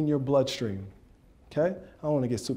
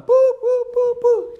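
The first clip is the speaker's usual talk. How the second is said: rapid-fire high, loud boops; punchy vocal sound effects; imitated snap sounds; 9 dB louder, slower than usual. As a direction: pop out a string of high boops. Imitating snaps and throws.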